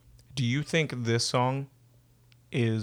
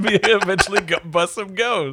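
high, loud, cracking up; talking through laughter; 8 semitones higher, 6 dB louder than usual. Real, not acted. Friend admits planning psychology to bust ghosts, laughing. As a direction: laugh your way through the words. Cracking up while finishing the joke.

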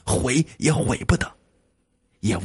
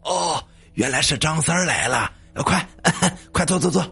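eager, higher-pitched greeting with a chuckle; smiling welcome; 5 semitones higher than usual. A narrator's reading. Second master welcomes Zhang San to sit, smiling and eager. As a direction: usher a guest in with a smile. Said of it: "还笑脸相迎呢".

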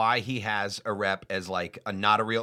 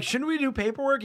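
high mock voice. Mocking friend's quote.

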